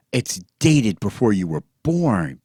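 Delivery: loud excited shout. A narrator's reading. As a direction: yell it out in excitement. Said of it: "Pee-wee fairly shouted".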